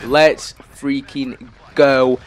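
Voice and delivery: loud, very drawn-out celebration; triumphant cheer. Real, not acted. Gamer celebrating the nuke he chased, triumphant cheer. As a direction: stretch each word out slowly and loudly.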